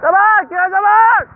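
high, loud call building up. Real, not acted. Calling out at fireworks.

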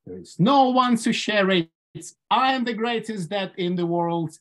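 ordinary talk

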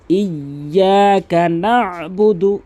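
chanted না'বুদু with a squeezed throat stop after না; pressed ayn. Shown as a demonstration. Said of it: "এখানে চাপ দিয়ে". (না'বুদু-তে আইনে চাপ দিয়ে)

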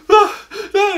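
high, loud crying whimpers; mock whimper. Boy realizing he'll miss his show, whimpering. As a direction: whimper like a kid about to bawl. Retold child starts to cry.

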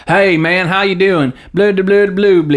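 put-on Southern US accent. Shown as a demonstration. Showing the accent derived from Cockney, in a Southern accent. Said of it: "the Southern accent".

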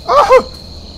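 high, loud startled cry; startled yelp. Real, not acted. Player jumping at the scare, startled yelp. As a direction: yelp in sudden fright.

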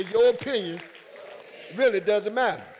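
preacher leading the church in a loud refrain; loud call-out. Real, not acted. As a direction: belt it so they repeat it. Congregation told to repeat.

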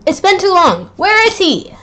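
high whiny impatience; impatient kid. Acted. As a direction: whine about the wait.

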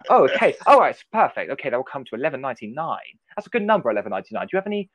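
chirpy Doctor chatter while serving at the till; Matt Smith impression. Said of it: "talking like Matt Smith the entire time".

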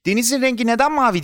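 put-on high, eager child's question; curious child. A narrator's reading. Boy asking his friend Deniz about the sea, curious. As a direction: ask it high, full of wonder.

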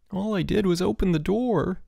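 high, whining protest; insisting. A narrator's reading. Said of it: "Perry kept insisting".

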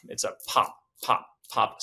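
short popping bursts; popping demo. Shown as a demonstration. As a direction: pop each word like a breath. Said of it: "It shouldn't be like a".

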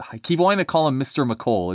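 ordinary delivery, nothing marked

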